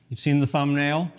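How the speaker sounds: ordinary delivery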